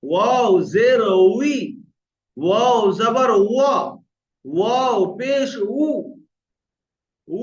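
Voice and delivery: slow, stretched spelling-out with rounded w on "উই", "ওয়া", "উ"; rounded-lip wao drill. Shown as a demonstration. Teacher drills wao's vowels, slow rounded "উই", "ওয়া", "উ". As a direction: draw out each w syllable, "উই", "ওয়া", "উ", firmly. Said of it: "এই হলো ওয়া এর উচ্চারণ".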